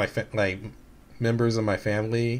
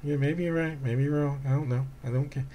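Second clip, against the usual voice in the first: put-on indifference; 3 semitones higher, 6 dB quieter than usual. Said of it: "I had to put on a face".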